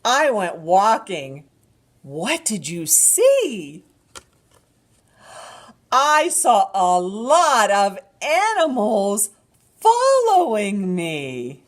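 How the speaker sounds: slow, animated picture-book reading; drawn-out storytime voice with gasps; reading to a toddler. Acted.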